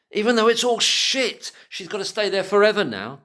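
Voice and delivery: high, loud outburst; angry rant. Real, not acted. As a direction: let the anger spill over. Ranting at the courts.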